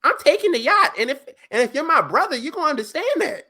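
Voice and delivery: high, loud, fast excited rant. Real, not acted. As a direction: push it up, all worked up. Excited rant about taking yacht.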